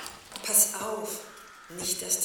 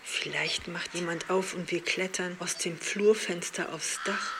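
usual speaking voice

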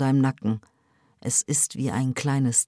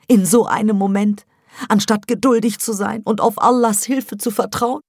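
high, loud, pained mother's voice; anguished mother; 7 semitones higher, 7 dB louder than usual. Acted. Mother defends her lost child, high and anguished. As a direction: plead as a wounded mother.

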